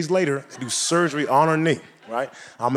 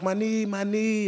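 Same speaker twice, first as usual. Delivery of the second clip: mock crying; 6 semitones higher, 6 dB quieter, slower than usual. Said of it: "and she's crying".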